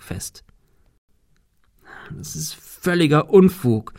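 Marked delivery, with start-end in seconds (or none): 2.1-3.9 higher, slightly halting protest; mild stammer. A narrator's reading. Climber protesting as he steps out of the window, mildly stammering. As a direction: stammer it with a touch of unease. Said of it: "stammelte er".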